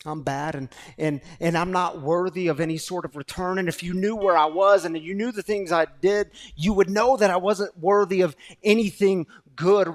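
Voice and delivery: loud, driving sermon delivery; full force preaching. Acted. Pastor preaching to people in recovery, loud and driving. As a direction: preach it out, pushing every phrase.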